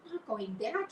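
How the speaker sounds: stunned cry of disbelief; cried out. Acted. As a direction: Wilbur cries out in disbelief. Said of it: "he cried".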